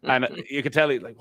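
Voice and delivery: ordinary talk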